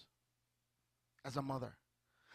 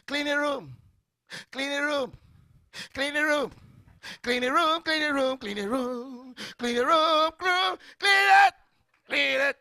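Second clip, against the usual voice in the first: high, louder nagging, building up; nagging parent; 8 semitones higher, 7 dB louder than usual. Acted. Parent nagging kids louder and louder to clean the room. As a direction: keep repeating until it's a yell. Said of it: "don't matter how you say, "Clean".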